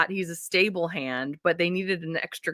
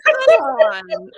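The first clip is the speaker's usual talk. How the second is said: exasperated; 8 semitones higher, 10 dB louder than usual. Retold or imitated. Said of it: "I was like".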